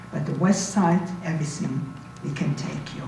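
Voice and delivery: ordinary delivery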